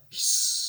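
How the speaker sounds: a soft hushing hiss; whispered hiss. Shown as a demonstration. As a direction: breathe out a quiet shh. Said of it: "برای ساکت کردن".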